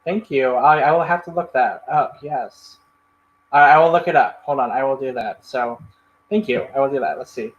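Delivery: ordinary talk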